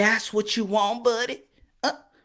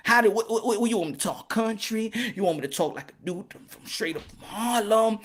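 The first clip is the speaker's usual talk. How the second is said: normal talking voice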